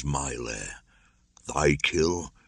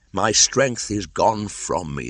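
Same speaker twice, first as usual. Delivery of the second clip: higher, louder, slow lament; moaning; 6 semitones higher, 4 dB louder than usual. Acted. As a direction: groan it out, worn down.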